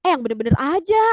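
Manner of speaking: acted astonishment; disbelief. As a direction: say it as if you don't believe it. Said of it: "Gak nyangka gitu ya".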